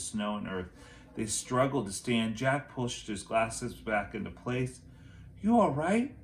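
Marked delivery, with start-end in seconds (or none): 5.4-6.2 high, loud worried check-in; concerned question. A narrator's reading. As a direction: ask anxiously if she's hurt. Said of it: "he asked Annie".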